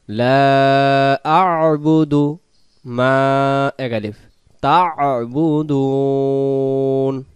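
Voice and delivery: slow melodic tajweed chant; Quran recitation. Shown as a demonstration. Teacher reciting the verse for students, slow chant. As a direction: recite slowly, stretching each vowel.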